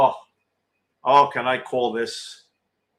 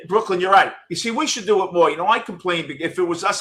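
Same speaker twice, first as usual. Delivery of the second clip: ordinary talk, nothing marked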